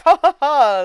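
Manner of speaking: loud, high "ha, ha, ha"; mock scheming laugh. Roberto plotting to trick Joseph, mock scheming laugh. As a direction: imitate a gleeful plotter's laugh.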